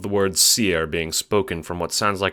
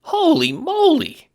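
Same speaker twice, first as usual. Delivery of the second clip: high squeaky startled yelp; squeaky little voice; 8 dB louder, slower than usual. Acted. Little guard squeaks in surprise at the Seer.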